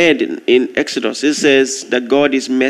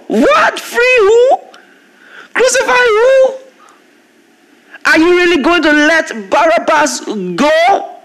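incredulous outburst; 12 semitones higher than usual. Real, not acted. Preacher to congregation, shocked the crowd chose Barabbas, high incredulous shout. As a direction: shout it in stunned disbelief.

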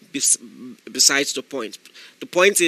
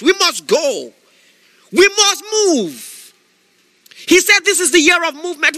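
higher, louder shouted preaching; fiery preaching; 9 semitones higher, 5 dB louder than usual. Acted. Preacher exhorting listeners not to make excuses, shouting. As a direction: preach it to the crowd, full force.

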